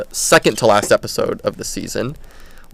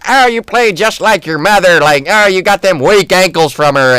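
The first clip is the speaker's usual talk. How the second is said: high, a bit louder mock of the taunting dad; mocking Cotton; 8 semitones higher, 4 dB louder than usual. Dad taunting his preteen son on the drive home, mocking. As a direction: sneer like the cruel father in the truck. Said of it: "being like".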